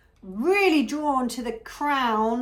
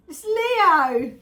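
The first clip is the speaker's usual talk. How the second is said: excited call; 7 semitones higher, 8 dB louder than usual. Real, not acted. Calling out husband's star sign.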